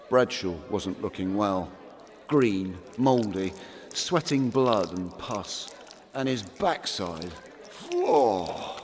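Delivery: normal talking voice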